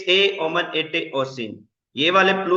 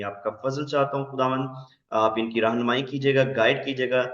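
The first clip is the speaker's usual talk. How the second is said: prayer; 5 semitones lower than usual. Acted. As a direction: pray earnestly over the students. Closing prayer for students.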